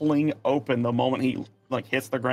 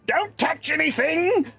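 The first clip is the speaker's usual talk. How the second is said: put-on high-pitched yelling old tinkerer; calling from above; 3 dB louder than usual. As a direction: holler it down in a high character voice. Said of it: "you hear from way up above".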